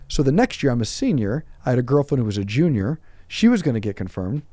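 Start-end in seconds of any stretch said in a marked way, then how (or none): none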